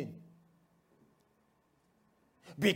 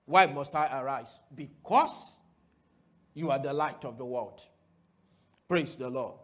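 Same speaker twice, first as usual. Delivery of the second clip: usual speaking voice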